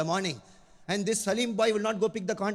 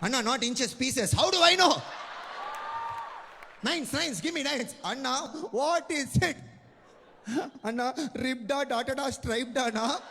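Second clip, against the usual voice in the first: higher-voiced young shop assistant; shop girl voice; 7 semitones higher than usual. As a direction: call down the options from the shelf. Shop girl's high loud questions.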